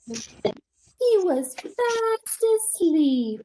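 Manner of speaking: very slow, stretching fast asleep; slow reveal. Acted. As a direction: savour the sleepy ending. Story climax, squirrel sleeps.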